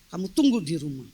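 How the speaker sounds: low, stern mock command; retold fierce order. Husband sending his wife home, low and stern. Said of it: "Wah, galak".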